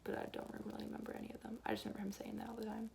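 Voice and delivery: quick whispered aside; whispering. Real, not acted. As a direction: murmur it fast under your breath. Aside after quoting teacher.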